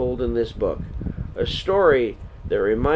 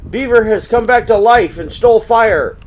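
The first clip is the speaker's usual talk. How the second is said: high, loud raising of the alarm; alarmed shout; 11 semitones higher, 10 dB louder than usual. Acted. Curlew warning the others about the fire, loud alarmed shout. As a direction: sound the alarm to everyone.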